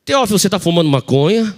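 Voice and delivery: grita a pergunta de repente, bem mais alto; pergunta gritada de surpresa. Retold or imitated. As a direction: solta a pergunta como um flagra, em voz alta. (tia flagra o sobrinho de surpresa, pergunta gritada)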